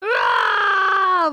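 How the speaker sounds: loud drawn-out scream; sudden shouted "ah" of alarm; startled yell. Real, not acted. Sudden yell as mouse is grabbed.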